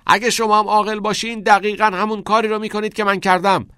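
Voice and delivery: very high, loud sustained yell; strained, insistent shout; shouting after them. A narrator's reading. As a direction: call after departing classmates at full voice. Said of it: "پشت سر آنها بلند داد زد".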